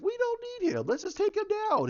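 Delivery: high, mock-indignant outburst; [name]. Teammate urging the others to attack, high-pitched outburst.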